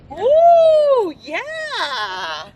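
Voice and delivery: loud, high ooh then a shouted yeah; delighted coach's whoop; excited whoop. Real, not acted. Coach cheering a lower squat.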